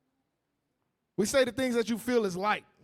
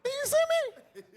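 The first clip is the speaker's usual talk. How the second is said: very high squeaky demon; mock demon; much faster than usual. Preacher mocks a revealed sister, in a very high squeaky demon voice. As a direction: squeal it like the unmasked spirit. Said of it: "how ... be when y'all get revealed".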